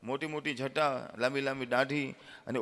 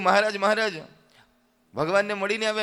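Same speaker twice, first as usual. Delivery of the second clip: eager calling; 4 semitones higher, 8 dB louder than usual. Acted. Villager runs up eagerly.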